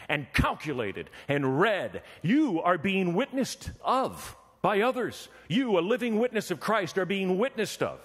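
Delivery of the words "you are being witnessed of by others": slow, high, weighted words; slow emphasis. Real, not acted. Preacher pressing the point on the congregation, slow and high. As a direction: lean hard on every word.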